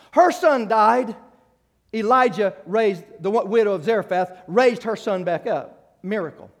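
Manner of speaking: loud, slowed, emphatic sermon delivery; slow preaching. Acted. Preacher retelling Elijah raising the widow's son, slow loud preaching. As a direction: lean hard on each word of the miracle.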